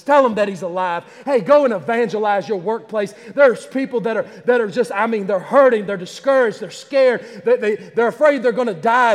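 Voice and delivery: high, loud, driving sermon delivery; impassioned pulpit crescendo; fervent preaching. Real, not acted. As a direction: preach it at full force, phrase piled on phrase. Preaches at full force.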